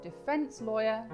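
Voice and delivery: ordinary talk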